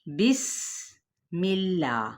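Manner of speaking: بسم اللہ very slow, with a thin ba and a whistled sin; slow model recitation. Shown as a demonstration. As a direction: draw بسم اللہ out as a demonstration. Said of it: "دیکھ لیتے ہیں".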